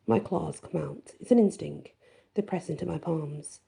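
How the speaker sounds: plain reading voice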